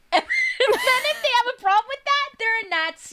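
high, loud laughing through the words. Real, not acted. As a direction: crack up while landing the joke. Punchline while laughing.

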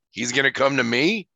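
rising question on "me". Retold or imitated. Vader questions the Emperor's prediction, questioning. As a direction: ask it, lifting "me" at the end. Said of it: "Vader questions the Emperor".

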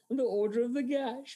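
high, giggly delivery; churlish teenage giggle. Acted. Teasing his friend over the film's name, giggling. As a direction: say it breaking into a juvenile giggle. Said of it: "giggled like a churlish thirteen-year-old".

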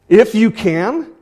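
high, loud and slow, in disbelief; incredulous. Retold or imitated. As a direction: echo his words back, amazed. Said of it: "he can't believe that this guy is saying this".

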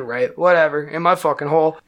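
ordinary delivery, nothing marked

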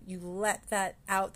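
usual speaking voice

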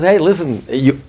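high, insistent voice of the soul; godly soul speaking up. Acted. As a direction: lay down the law as the soul, pitched high. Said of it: "And the godly soul says".

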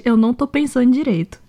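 usual speaking voice

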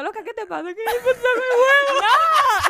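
high, loud, hammed-up sobbing impression, laughing through it; mock crying. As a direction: parody a friend wailing between laughs. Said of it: "Di que Gabriela llorando". (imitación exagerada de llanto, entre risas)

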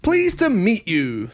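high-pitched friendly genie voice. A narrator's reading. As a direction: greet like a storybook genie. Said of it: "the genie said".

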